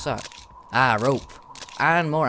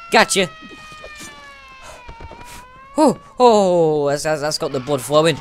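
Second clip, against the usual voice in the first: excited gamer; 8 semitones higher, 8 dB louder than usual. Real, not acted. Fighting enemies with knife.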